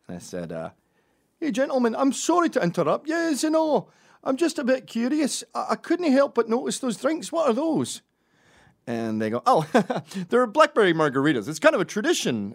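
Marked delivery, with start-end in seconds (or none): 1.4-8.0 high, loud Scottish busybody; over-polite Scottish accent, pitched way up. Acted. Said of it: "hard to say with a Scottish accent". 9.5-12.5 high, loud chuckling reply; pleased drinker, pitched up; cheerful stranger. Acted. Strangers answer cheerfully with a laugh.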